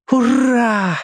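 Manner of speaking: high breathy cheer; joyful exhale. A narrator's reading. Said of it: "выдохнул Том".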